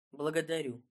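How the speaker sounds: short, dry thanks; curt. A narrator's reading. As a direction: drop it curtly. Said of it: "лаконично обронил Разумовский".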